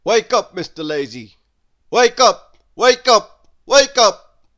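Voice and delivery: loud, high repeated calls; calling to wake him. Acted. Someone loudly calling Mister Lazy to wake up. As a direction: rouse a sleeper.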